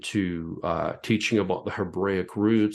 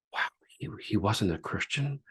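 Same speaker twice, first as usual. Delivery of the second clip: barely voiced, hushed with disbelief; hushed whisper; 5 dB quieter than usual. Pastor recalling his shock to the audience, whispered. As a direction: breathe it out in an awed whisper. Stunned realization mid-story.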